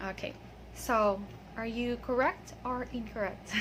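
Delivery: ordinary talk, nothing marked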